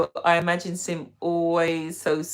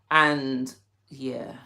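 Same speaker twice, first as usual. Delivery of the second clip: normal talking voice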